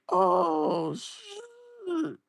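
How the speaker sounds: half laughing, half crying out. Real, not acted. Reacting to the photo, cry-laughing in disbelief.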